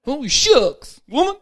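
high, put-on disappointed old man; husband grumbling at his wife, high and loud. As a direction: sulk like a let-down old husband.

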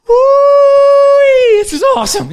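high, loud joyful shout; old husband thrilled on the plane ride; thrilled whoop. Acted. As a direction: whoop with delight in the open cockpit. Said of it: "He's like".

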